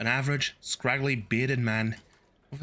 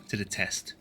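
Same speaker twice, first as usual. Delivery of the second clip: ordinary delivery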